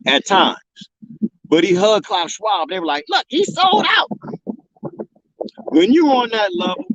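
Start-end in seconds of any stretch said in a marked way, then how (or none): 3.1-4.1 high mocking voice; mocking critics. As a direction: mimic the critics, pitched way up.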